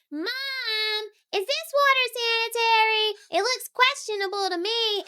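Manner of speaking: put-on high, squeaky little boy voice; a child worried about the water, high and fussy; boy's voice. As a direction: pitch way up as a fussy young boy. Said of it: "he goes".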